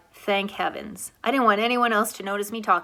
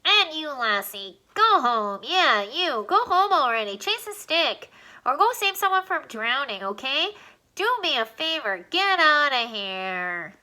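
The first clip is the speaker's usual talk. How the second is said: a squeaky alien acting tough; 4 semitones higher than usual. Alien orders the collie to go home, squeaky and tough. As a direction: shoo the dog like a little boss. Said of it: "before turning tough".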